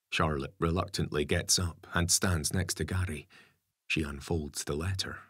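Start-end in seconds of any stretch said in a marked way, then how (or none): none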